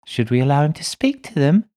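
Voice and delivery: put-on high, loud little girl voice; a spirit child speaking through a medium; little girl's voice. A narrator's reading. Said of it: "as if she's addressing someone else".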